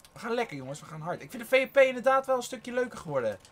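ordinary talk

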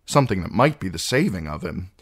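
usual speaking voice

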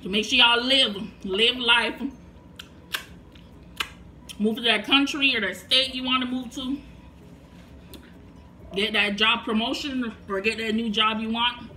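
speaking between bites; talking while chewing. Real, not acted. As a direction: mouth partly full of food. Seen 0: Cheeks bulge and mouth works between words; she eats throughout.

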